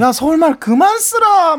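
loud high slow Busan reproach; scolding Busan sibling. Retold or imitated. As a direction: bark it like a younger brother from Busan. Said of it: "이 동생 같은 그런 반응이".

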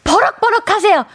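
high-pitched playful blow-up; 박명수. Retold or imitated. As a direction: urge them on with a mock outburst. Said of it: "우리 박명수님처럼 버럭버럭". (버럭하는 흉내로 높게)

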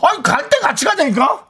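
high-pitched comic objection; acted indignant protest. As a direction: protest at being left behind.

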